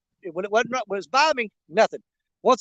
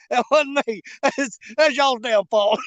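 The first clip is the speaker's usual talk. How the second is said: laughing protest; 3 semitones higher, 5 dB louder, slower than usual. Real, not acted. Angler jokingly blaming fans, loud and laughing. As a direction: push the words out fast through laughter. Blames fans while cracking up.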